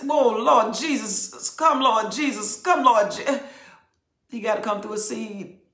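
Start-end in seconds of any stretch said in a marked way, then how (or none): 0.0-3.4 mock fervent calling. Folks calling on Jesus.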